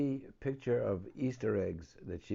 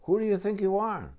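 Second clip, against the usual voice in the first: indignant cry; 9 semitones higher, 10 dB louder than usual. Acted. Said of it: "cried the Rainbow Fish".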